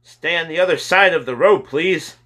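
high, loud shouted order; policemen yelling. A narrator's reading. Policemen to a crowd at a fire, yelling. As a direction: yell it out to push a crowd back. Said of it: "policemen yelling at people".

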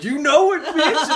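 high, loud laughing brag; teen revealing himself under the mask; cocky reply. Retold or imitated. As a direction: gloat like a proud prankster. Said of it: "I'm like".

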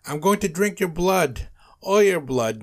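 loud, high vampire threat; yelling. A narrator's reading. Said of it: "Nosferatu yelled".

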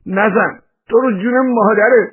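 agitated high, loud explaining; pleading under attack. A narrator's reading. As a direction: protest and beg for mercy. Beaten man pleads and explains.

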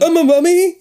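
high, loud disbelief; shocked cry. A narrator's reading. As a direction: cry out in horror. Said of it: "you cry".

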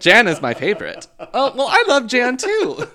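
loud, high, overexcited squeal; gushing mock answer. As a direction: gush about Jan at full volume.

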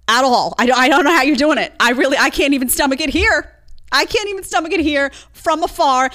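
loud, high exasperated outburst; exasperated disbelief. Real, not acted. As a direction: raise pitch and volume in disbelief. Real outraged rant at the topic.

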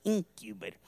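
low, drawn-out southern "Incubator"; southern drawl. Shown as a demonstration. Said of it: "That's a good southern drawl".

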